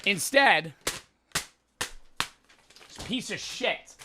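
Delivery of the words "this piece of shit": scornful whisper. Real, not acted. Disgust at cheap magic pen.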